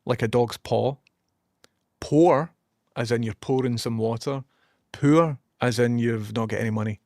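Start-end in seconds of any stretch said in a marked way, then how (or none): 2.0-2.5 "Pour" with an o and a sounded r; Scottish-accented "Pour", r pronounced. Shown as a demonstration. Scot showing English listeners "Pour", clearly differentiated. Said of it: "can differentiate more words than an English accent". 4.9-5.4 "Poor" with an oo and a sounded r; Scottish-accented "Poor", distinct from pour. Shown as a demonstration. Scot showing English listeners "Poor", clearly differentiated. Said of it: "can differentiate more words than an English accent".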